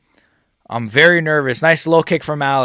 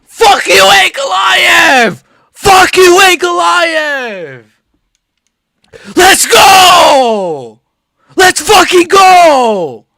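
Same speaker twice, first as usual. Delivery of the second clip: much higher, louder slow shouts; triumphant shouting; 11 semitones higher, 8 dB louder, much slower than usual. Real, not acted. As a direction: roar it, drawn out. Celebrating the knockout.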